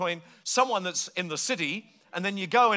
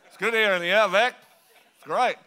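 louder, mumbled Aussie through shut lips; closed-mouth outback Australian; 4 dB louder than usual. Shown as a demonstration. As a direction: keep the flies out, Australian. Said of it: "So you just say".

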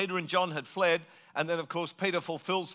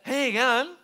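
higher, mumbled Aussie greeting; slurred rural Australian; slurred outback Australian; 7 semitones higher, 3 dB louder than usual. Shown as a demonstration. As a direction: echo the Australian slur, puzzled.

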